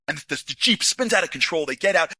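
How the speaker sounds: ordinary delivery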